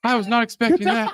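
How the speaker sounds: high, loud shocked laugh. Real, not acted. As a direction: burst out laughing, surprised.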